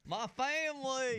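mock Southern drawl, drawn out; Southern twang. Host mocking the coach's adopted accent, Southern twang. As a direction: stretch it in an exaggerated Southern twang. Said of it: "his little Southern accent, his little Southern twang".

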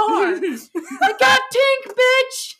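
high, slow, gleeful crowing; triumphant yell. Pretend. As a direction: gloat loud over the catch. Catching Tinkerbell in a jar.

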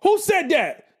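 loud, high call across the bus; mock bus shout. Kid on the bus yelling at the whole bus. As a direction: yell it like a kid stirring things up.

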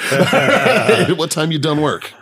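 slow, laughing repeat of "What time you done work?"; laughing mock quote. Mocking the Maryland phrase to friends, laughing and slow. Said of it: "You people are weird".

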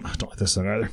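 low, soft bored muttering. Acted. Joke teller as bored driver flipping stations, bored. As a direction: mutter it, mildly fed up. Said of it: "he's kinda bored".

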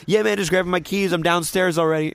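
yelling it, toned down for the retelling; retold yell. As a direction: call it out like you're yelling through a door. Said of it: "I yelled it. Like, I yelled it to him".

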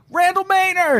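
high, loud introduction call; hyped announcement. Pretend. Host introduces the returning guest to the show, hyped and loud.

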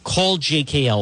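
ordinary delivery

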